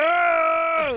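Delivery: long high comic wail; mock cry. Mimes Cosby's cry in joke.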